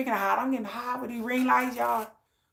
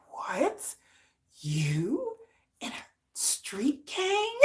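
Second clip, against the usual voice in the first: hushed, drawn-out question climbing high; slow rising squeak of disbelief; mock disbelief; 4 semitones higher, 5 dB quieter, much slower than usual. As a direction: imitate stunned kids, softly incredulous. Kids stunned by mother's gang past.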